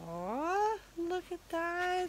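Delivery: high, slow, adoring coo. Real, not acted. Cooing over dressed doll.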